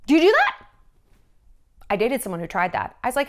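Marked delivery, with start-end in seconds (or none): 0.1-0.7 much higher and louder, accusing; are you serious?; incredulous. Real, not acted. Host demanding whether a listener wears cargo shorts, disbelieving.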